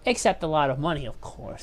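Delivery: ordinary talk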